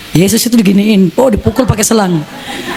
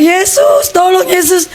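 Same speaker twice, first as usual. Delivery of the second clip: high desperate mock cry to Jesus; screaming for help; 8 semitones higher than usual. Beaten boy crying out to Jesus, high desperate retold scream. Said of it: "Pas saya teriak".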